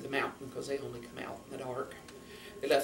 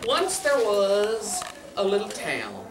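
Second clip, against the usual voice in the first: slow, raised story opening; storytelling; 7 semitones higher, 7 dB louder, slower than usual. A narrator's reading. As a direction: open the tale with drawn-out words. Begins telling the next tale.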